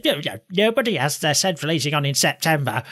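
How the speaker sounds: a lightly put-on East Yorkshire mum, dismissive; bad East Yorkshire accent. Mother refusing to switch the heating on, mock East Yorkshire accent. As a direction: imitate a Yorkshire mother brushing off the request, accent only half there. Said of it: "that's a terrible East Yorkshire accent".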